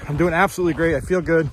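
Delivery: normal talking voice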